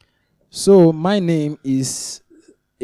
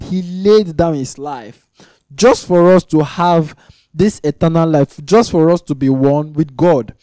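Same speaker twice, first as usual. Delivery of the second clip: loud, high, driving sermon voice; fervent preaching; 3 semitones higher, 6 dB louder than usual. Acted. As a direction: preach it out, rising and insistent. Preaching at full force.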